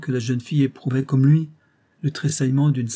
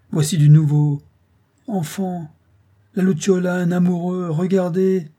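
high, loud call to companions; excited, jeering announcement; mocking call; 7 semitones higher, 5 dB louder than usual. A narrator's reading. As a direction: crow over the discovery. Mocking rival calls to his companions.

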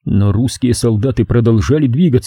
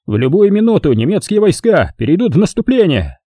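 higher, confident proclaiming; pompous holding forth; 8 semitones higher than usual. A narrator's reading. Sergeant boasting to soldiers, pompous and higher. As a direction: preach victory to the trench. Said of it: "продолжал разглагольствовать фельдфебель".

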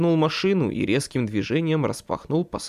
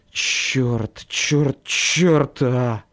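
muttered curses; 4 dB louder, much slower than usual. Acted. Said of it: "пробормотал я".

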